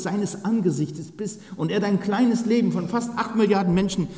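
fervent preaching. Acted. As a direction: build the praise up for the church. Preaching builds up. (leidenschaftliche Predigt)

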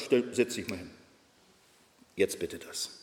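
Gott spricht leise und bestimmt; leise, ruhig befehlend. Pretend. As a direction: ruhig wie zu einem Hund sagen. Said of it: "Gott sagt". (leise, gelassene Anweisung Gottes)

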